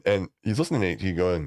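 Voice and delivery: usual speaking voice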